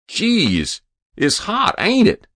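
high, loud, slangy complaint; brash lout. A narrator's reading. Hero breaking the silence before the President, brashly. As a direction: complain loudly in a high, cocky voice.

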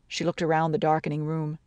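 ordinary delivery

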